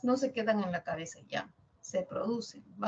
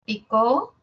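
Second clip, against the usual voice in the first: "pico" louder with a sharply rising end; tone demonstration; 6 dB louder than usual. Shown as a demonstration. Teacher tries tones on one word, rising tone. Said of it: "Yo tengo un pico con un tono".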